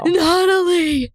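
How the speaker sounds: put-on high, slow old man cry. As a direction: wail the name like an old man. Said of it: "He says it like, like an old man".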